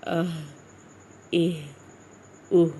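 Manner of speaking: "اہ" pitched low, the h from the chest; short, low "اہ" from the chest; deep chest h. Shown as a demonstration. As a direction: place the end of "اہ" deep in the throat. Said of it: "سانس بالکل نہیں ہوگا".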